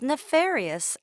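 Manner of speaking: the parent stressing nefarious with a firm fall; complaining parent. A narrator's reading. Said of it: "eavesdrop on a parent".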